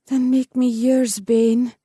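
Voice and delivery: eager heroine offering herself; heroine's ardent plea. A narrator's reading. Heroine pleading with lover.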